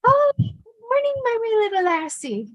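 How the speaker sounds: high, lilting Scottish granddad greeting; grandpa voice. Retold or imitated. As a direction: sing out a cheery morning to the little girl. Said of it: "He would go".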